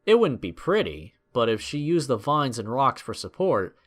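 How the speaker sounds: normal talking voice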